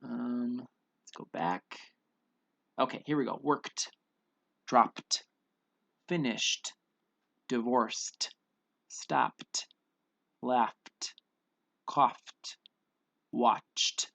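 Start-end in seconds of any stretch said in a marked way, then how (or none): 3.4-14.1 each word one syllable with a breathed t; deliberate word list, ed said as t; final T sound. Shown as a demonstration. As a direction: pop an airy t at the end of each. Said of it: "They all have that T sound".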